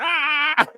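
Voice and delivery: high mock shriek; acted victim scream, very high; imitated scream. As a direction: squeal in exaggerated horror. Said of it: "then we see him screaming".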